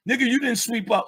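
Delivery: hammed-up nagging mom; mock mom scolding.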